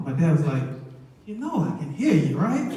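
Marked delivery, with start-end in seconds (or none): 1.3-2.7 laughing, higher, teasing. Retold or imitated. Dad overhearing mom's advice, laughing teasingly.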